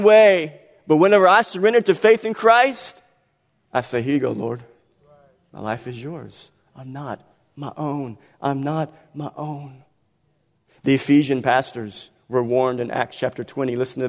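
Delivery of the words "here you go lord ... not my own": hushed personal prayer to the Lord; quiet prayer. Pretend. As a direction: hand it over gently to the Lord. Voiced prayer of surrender.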